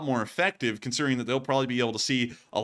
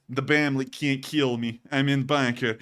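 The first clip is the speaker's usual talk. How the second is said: mock Russian accent; 2 semitones lower than usual. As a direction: play a Russian soldier in broken English. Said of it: "He's going".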